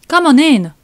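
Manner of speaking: high, loud welcoming call; calling someone in. Acted. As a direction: holler an invitation across the yard. Rose calls the visitor in.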